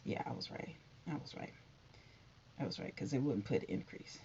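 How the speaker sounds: murmured under breath, unvoiced; whispered smug aside; quiet self-talk. Real, not acted. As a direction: mutter it to yourself, pleased. Muttering to herself over headline.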